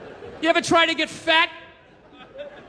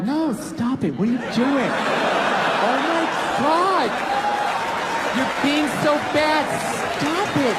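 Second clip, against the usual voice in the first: coy fake refusal. Woman pretending to resist advances, coy and teasing. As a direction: protest playfully while inviting more. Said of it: "that's not a fucking no".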